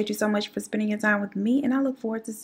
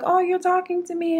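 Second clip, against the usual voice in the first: high, surprised little question; surprised neighbor; 10 semitones higher, 3 dB louder than usual. Retold or imitated. Neighbor surprised the puppy barked at her, high and sweet. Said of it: "the girl is like".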